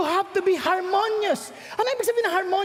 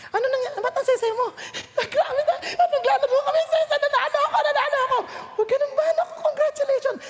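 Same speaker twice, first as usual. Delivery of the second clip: higher, laughing delight; happy child; 7 semitones higher, faster than usual. Retold or imitated. Child boasting of winning to parent, laughing. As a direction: bubble over with winning.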